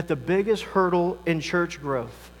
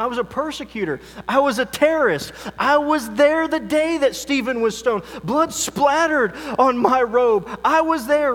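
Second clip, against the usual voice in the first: high, forceful confession in Paul's words; fervent pulpit retelling as the apostle; impassioned preaching; 6 semitones higher, 4 dB louder than usual. Acted. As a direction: preach the testimony with rising force.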